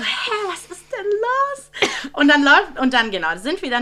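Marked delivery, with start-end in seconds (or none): 0.0-2.1 much higher, slow whimper of confusion; near tears. Retold or imitated. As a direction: sound on the verge of crying. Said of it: "ich hätte fast angefangen zu heulen". (fast weinerlich, hoch und langsam)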